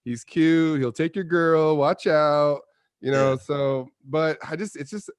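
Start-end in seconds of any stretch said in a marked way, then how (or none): 0.0-2.6 mocking tease. Mocking [name]'s typecast charm.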